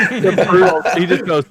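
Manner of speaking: usual speaking voice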